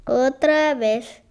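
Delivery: ordinary delivery, nothing marked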